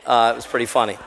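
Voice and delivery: usual speaking voice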